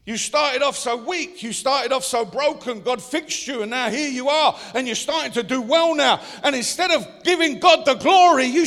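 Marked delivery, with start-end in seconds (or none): none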